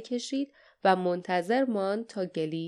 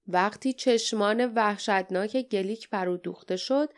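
usual speaking voice

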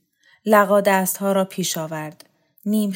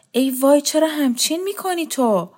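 alarmed cry; 7 semitones higher than usual. A narrator's reading. Mother alarmed by daughter's fit.

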